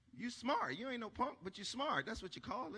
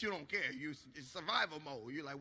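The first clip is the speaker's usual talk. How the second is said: usual speaking voice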